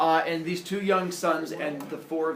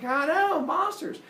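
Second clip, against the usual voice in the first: high, raised insistent hero; mock excited agreement; 13 semitones higher, 3 dB louder, slower than usual. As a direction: chime in over the screams, pitched high. Hero talks over the screaming.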